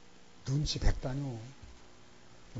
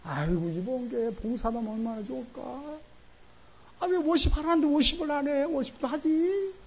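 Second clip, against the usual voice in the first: urging church elder; 11 semitones higher, 3 dB louder than usual. Retold or imitated. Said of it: "한 분이 그런 거예요".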